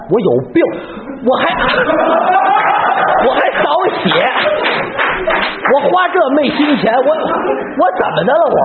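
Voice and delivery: high, fast exasperated rant with a laugh in it; the emperor sputtering in disbelief at his minister; worked-up emperor. Acted. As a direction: Qianlong flustered, ranting at Heshen. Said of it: "您别激动".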